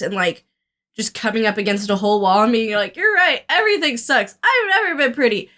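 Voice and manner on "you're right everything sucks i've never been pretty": high-pitched, acted-out despair; imitating a self-pitying meltdown; mock breakdown. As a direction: wail it lightly, in mock defeat. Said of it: "Has a full breakdown".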